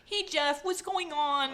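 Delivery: put-on high sing-song baby talk to a friend; cutesy baby voice. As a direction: coo at your buddy like a baby. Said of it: "talk in a baby voice".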